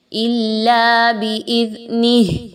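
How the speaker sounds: slow recitation ending on a stopped ه; recited with a pause stop. Shown as a demonstration. Teacher models the stop for students, slow recitation. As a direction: chant it and stop dead on the last letter. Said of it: "جب وقف کرنا ہے".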